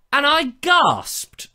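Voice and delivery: hammed-up gasp of surprise; mock astonishment. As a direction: stretch it out like a shocked showman. Storytelling climax.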